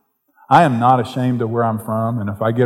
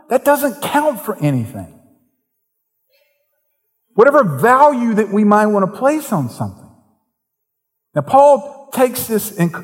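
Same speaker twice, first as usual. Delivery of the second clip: preaching at full force; 8 semitones higher than usual. Acted. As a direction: raise the sermon to a fervent peak.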